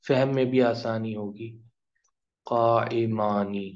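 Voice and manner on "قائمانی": slow, drawn-out Arabic word; slow teaching pronunciation; slow model word. Shown as a demonstration. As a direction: spell it out slowly for the class. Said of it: "اونا کی آواز آئے گی".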